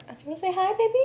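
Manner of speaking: high-pitched cooing to a pet; pet talk. Real, not acted. Talks to pet on camera.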